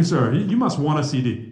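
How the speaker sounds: usual speaking voice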